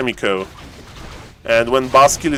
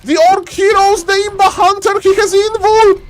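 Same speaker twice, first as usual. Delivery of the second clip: much higher, rising hype; excited caster. Real, not acted. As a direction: call the fight with mounting excitement. Hyped play-by-play climax.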